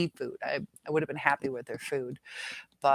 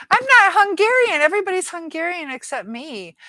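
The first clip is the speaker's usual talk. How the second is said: loud protest; 13 semitones higher, 8 dB louder than usual. Real, not acted. Protests the heritage mixup.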